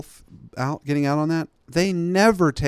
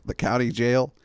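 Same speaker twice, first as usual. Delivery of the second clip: normal talking voice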